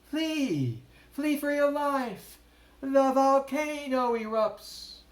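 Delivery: high, loud alarm cry; frantic shout; shouted warning. A narrator's reading. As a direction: yell to scare the shark. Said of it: "Then he shouted".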